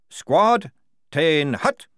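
high, loud call to attention; corporal's parade-ground order; drill command. A narrator's reading. Corporal calls squad to attention.

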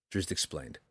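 ordinary delivery, nothing marked

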